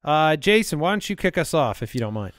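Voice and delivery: normal talking voice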